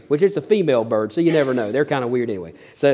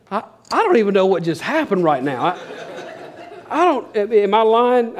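laughing disbelief; 5 semitones higher than usual. Real, not acted. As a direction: laugh through the question, still amazed. Laughing at the memory.

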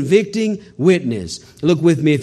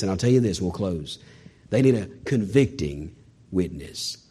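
hushed close; 9 semitones lower, 8 dB quieter than usual. Acted. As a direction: lower and much softer, slowing right down. Preacher drops voice to close.

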